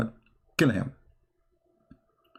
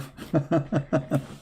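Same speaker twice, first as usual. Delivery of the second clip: higher, rippling chuckle; chuckling laugh; 4 semitones higher than usual. Real, not acted. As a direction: laugh at your own joke. Amused by own joke.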